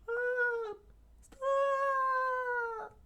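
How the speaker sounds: thin falsetto scream at moderate volume; very high cry, as if heard from a distance; acted high-pitched scream; scream from above. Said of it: "a voice screamed from above".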